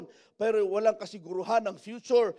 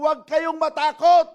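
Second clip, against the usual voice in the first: raised, high-pitched proclamation; Moses proclaiming; 7 semitones higher, 12 dB louder than usual. Acted. Moses telling the people not to fear, loud proclamation. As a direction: call it out loud to a whole crowd. Preacher voices Moses reassuring frightened crowd.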